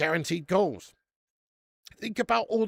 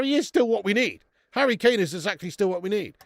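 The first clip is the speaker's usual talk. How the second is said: ordinary talk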